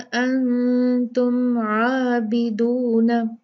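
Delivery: very slow chanted tajweed recitation; Quranic recitation. Shown as a demonstration. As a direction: recite it, drawing out the madd. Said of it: "वला". (धीमी तजवीद वाली तिलावत)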